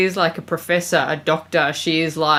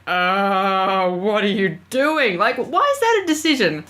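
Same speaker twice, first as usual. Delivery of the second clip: incredulous; 2 semitones higher than usual. Retold or imitated. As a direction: ask it like you can't believe what you saw. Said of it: "And I'm just like".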